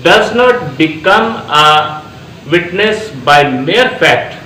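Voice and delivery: reading the law text out very slowly, word by word; slow dictation. Acted. Lecturer reading article one thirty four to students, slow dictation. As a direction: dictate each phrase so students can write it down. Reads statute text aloud.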